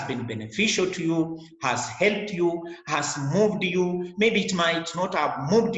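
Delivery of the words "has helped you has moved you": usual speaking voice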